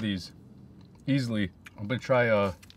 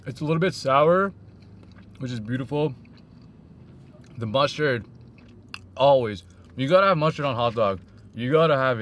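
muffled words between bites; talking while chewing. Real, not acted. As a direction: mouth partly full of food. Seen 2: Bites hot dog before, lips closed chewing between words throughout.

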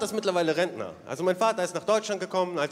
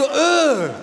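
loud, higher outraged disbelief; shocked disbelief; 5 semitones higher, 6 dB louder, slower than usual. Retold or imitated. Son stunned at father addressing police informally, loud disbelief. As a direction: shout it, stunned at your father. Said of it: "Ich so".

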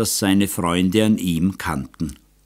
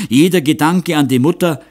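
JEDER hit higher and louder, accusing; "Jeder" thrown out higher and louder at her; accusing outburst; 6 semitones higher, 4 dB louder than usual. Acted. Bitter reproach builds to climax.

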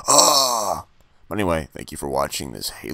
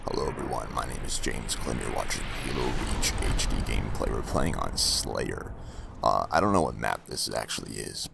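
lower, whispered character voice; 7 semitones lower than usual. Acted. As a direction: speak low and hushed, in character. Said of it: "if you're wondering why I'm talking like this".

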